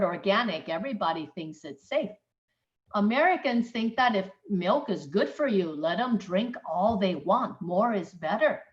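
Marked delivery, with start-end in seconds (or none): none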